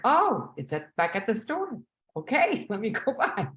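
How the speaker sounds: oh, found it, with a laugh; excited relief with a laugh; relieved discovery. Retold or imitated. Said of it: "I'm like".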